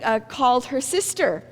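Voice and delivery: normal talking voice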